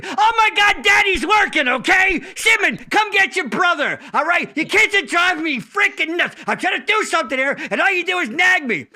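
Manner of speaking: put-on high, loud exasperated yell at the kids; Larry blowing up. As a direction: snap like a frazzled dad losing it. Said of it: "and finally we".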